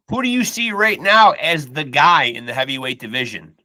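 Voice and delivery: normal talking voice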